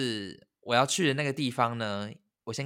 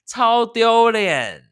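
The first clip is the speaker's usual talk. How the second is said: drawn out, much higher and louder wail; mortified; 11 semitones higher, 13 dB louder, much slower than usual. Real, not acted. Telling her friend how embarrassing it was, drawn-out wail. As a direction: stretch it out in agony.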